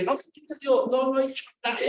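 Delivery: high, flustered protest with a laugh; startled denial. Retold or imitated. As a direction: blurt out the denial, caught off guard. Startled denial, pastor took mic. (sorpresa nerviosa, aguda y con risa)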